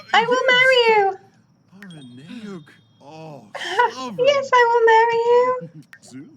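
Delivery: high, fast gushing voice with a giggle; voicing the bride. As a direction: squeal it like a giddy bride. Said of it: "She's like".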